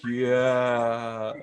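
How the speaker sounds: long, drawn-out, loud mock yeah; awkward cheer. Imagining clubbers fist pumping to the remix, awkward drawn-out cheer. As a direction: stretch it out like an awkward club-goer. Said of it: "It'd be the most awkward thing ever".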